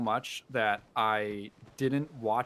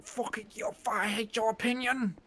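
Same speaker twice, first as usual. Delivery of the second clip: much higher, mocking ranting voice; mock angry YouTuber; 11 semitones higher than usual. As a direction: parody a smug ranting YouTuber, pitched way up. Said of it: "he spends a lot of that video going".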